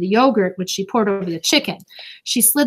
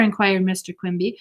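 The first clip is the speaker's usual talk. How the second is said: usual speaking voice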